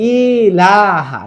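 ইলাহা with the ই wrongly drawn out, slow; wrong reading. Shown as a demonstration. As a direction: drag the ই of ইলাহা slowly, the common mistake. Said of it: "অনেকে বললো".

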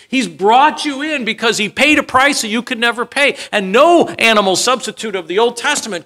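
high, loud preaching drive. Acted. Preacher driving the point to his congregation, full force. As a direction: press the point home to the crowd.

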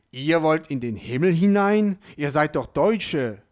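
lauter, erstaunter Türhüter; entrüstet nachfragen, laut; empörter Petrus. A narrator's reading. Petrus empört über Deutsche. (Petrus empört zu den Frankfurtern, laut)

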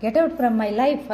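ordinary delivery, nothing marked

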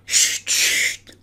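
whispered shush. A narrator's reading. Hatter and Hare silencing Alice in a whisper. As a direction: hush her with a whisper.

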